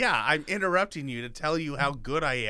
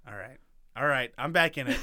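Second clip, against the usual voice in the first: ordinary delivery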